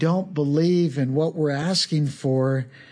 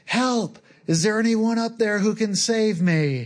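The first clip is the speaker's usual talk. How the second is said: screams for help; 6 semitones higher than usual. Retold or imitated. Hiker calling up to the clifftop, high-pitched mock scream. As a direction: pitch it up like a desperate shout. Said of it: "and he screams".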